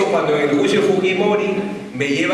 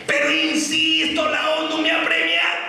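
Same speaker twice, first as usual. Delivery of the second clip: insistent angry expert; 9 semitones higher than usual. Retold or imitated. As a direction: dig in on the UN prize. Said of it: "entra con la sangre en los ojos".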